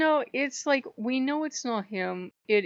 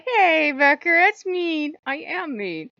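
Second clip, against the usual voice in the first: higher, louder, drawn-out playful protest; mock scolding exchange; 8 semitones higher, 8 dB louder, slower than usual. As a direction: quote a teasing complaint and cheeky comeback, loudly. Joking retort exchange amid laughter.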